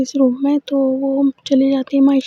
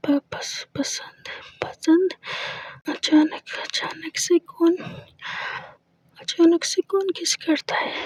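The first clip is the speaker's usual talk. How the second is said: shy, breathless stammering, dropping much softer; flustered stammer; 2 semitones higher, 6 dB quieter than usual. Acted. Aina flustered after Maysha's sudden kiss, soft shaky stammer. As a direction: stumble over the words, caught off guard and blushing.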